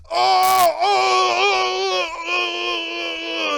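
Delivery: high shouted cries of pain. Acted. Luca crying out as his hand is stabbed. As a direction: cry out as the knife goes in.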